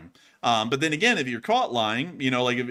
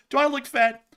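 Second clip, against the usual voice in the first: much higher, louder mock-wife voice; imitated wife; 14 semitones higher, 5 dB louder than usual. Wife comes up and asks her husband, high mock voice. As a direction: pitch it way up as the questioning wife. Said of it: "when your wife comes up and says".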